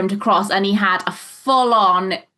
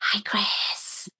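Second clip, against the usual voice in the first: whisper; 9 dB quieter than usual. Real, not acted. Greeting a listener in background.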